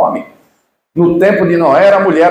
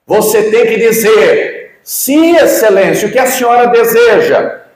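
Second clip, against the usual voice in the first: mock shout; 5 semitones higher than usual. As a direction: scream it at the witness.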